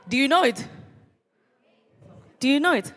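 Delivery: high, loud challenge; rousing question. Real, not acted. Speaker pushing students to answer, high and loud. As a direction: fire up the crowd.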